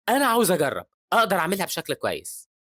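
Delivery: high, loud eager declaration. Acted. Boy insists on joining in despite Joe's objection, loud. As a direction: pipe up loudly over an objection. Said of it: "بيقول بصوت عالي".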